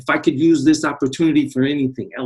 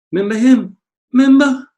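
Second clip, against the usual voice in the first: excited callback; 10 semitones higher, 6 dB louder than usual. Acted. Playful callback to old star.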